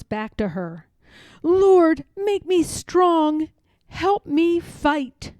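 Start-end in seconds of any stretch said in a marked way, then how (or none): 1.4-5.3 higher, louder, slow earnest plea; a fervent, pleading prayer; Harriet praying. Acted. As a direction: cry out to the Lord for strength. Harriet prays aloud.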